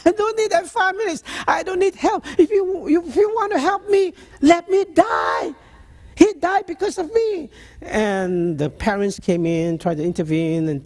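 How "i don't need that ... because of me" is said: screaming patient. Retold or imitated. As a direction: shout him away in despair. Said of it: "screaming, yelling, and shouting".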